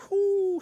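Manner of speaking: high, loud burst of excitement; excited whoo. Real, not acted. Fan whooping while describing the winning volley. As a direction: whoop at the memory of the goal.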